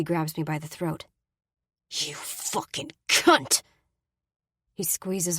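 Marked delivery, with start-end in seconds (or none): 1.9-3.6 grind out the insult with contempt; enraged insult. Acted. Brady grabs her throat.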